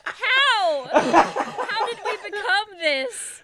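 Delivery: high, loud, laughing questions; real helpless laughter after the joke; laughing disbelief. Real, not acted. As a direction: gasp it out through laughter. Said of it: "I'm so ashamed".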